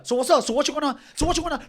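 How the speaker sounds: imitating a cocky guy daring you; taunting challenge. Opponent taunting before fight.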